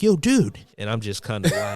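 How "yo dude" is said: high, loud dude voice; imagined friend's voice. Retold or imitated. Reading a friend's email in his imagined voice, high impression. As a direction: imitate a buddy, pitched way up. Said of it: "this is how it sounded".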